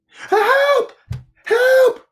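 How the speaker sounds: very high, loud squeal for help; squeaky, full-voiced alarm; panicked Piglet cry. A narrator's reading. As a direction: shriek it as a terrified little piglet. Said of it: "cried Piglet".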